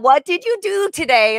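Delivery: high, loud mock British question; British accent. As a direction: ask it like a prim Englishwoman. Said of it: "You almost sound British".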